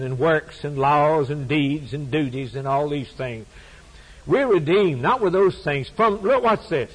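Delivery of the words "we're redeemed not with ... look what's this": impassioned sermon climax; preaching. Acted. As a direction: drive the point home from the pulpit. Preaching at full force.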